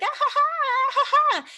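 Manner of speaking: high, shrill mock screams; mock screaming. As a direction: squeal like a scared rafter. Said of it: "but they're like".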